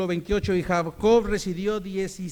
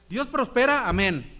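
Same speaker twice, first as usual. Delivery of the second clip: preaching call; 3 semitones higher, 5 dB louder than usual. Acted. Call and response to congregation.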